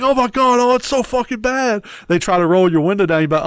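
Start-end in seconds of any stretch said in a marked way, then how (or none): 0.0-1.8 high-pitched mock outcry of disgust. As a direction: wail it like someone hit by a stink. Imitates driver disgusted by fart smell.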